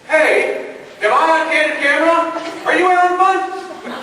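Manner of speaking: high, loud, suspicious call out. Retold or imitated. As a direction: shout to the technician outside, half joking. Calling out from inside the tube.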